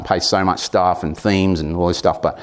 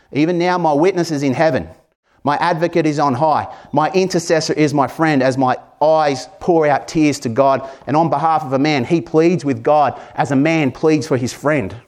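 lifted, higher reading of Job's words; scripture reading; 4 semitones higher than usual. Acted. Pastor reading Job's plea to the congregation, higher and earnest. Reads Job sixteen aloud.

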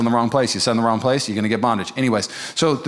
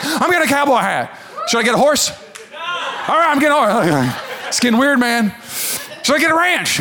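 high, laughing, getting carried away; egged on and loving it; rising giddy laughs as the crowd cheers; laughing excitement; 10 semitones higher than usual. Real, not acted. Crowd eggs him on.

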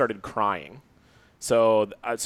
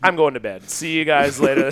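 fed up, done; 3 semitones higher, 6 dB louder than usual. Retold or imitated. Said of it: "I was like".

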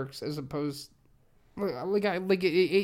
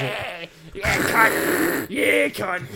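hammed-up Australian yell; rough Australian caricature, rising louder; mock Australian bogan; 2 semitones higher, 5 dB louder, much slower than usual. Comedian playing the newborn bogan, mock Australian accent.

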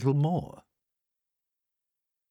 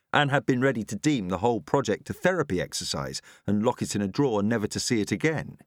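usual speaking voice

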